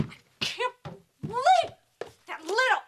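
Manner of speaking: high, choked, broken-off outrage; too angry to finish; sputtering rage. Acted. Mother fumes about her daughter, sputtering in outrage.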